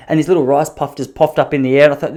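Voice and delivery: ordinary delivery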